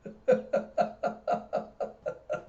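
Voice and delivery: high, breathless giggle; long staccato wheezy laugh; giggling. Real, not acted. Storyteller cracks up over the juice scene, giggling.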